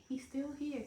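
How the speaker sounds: very soft, amazed aside; hushed wonder. Acted. Wife seeing her husband in bed, hushed wonder. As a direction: drop to a hush, marvelling to yourself.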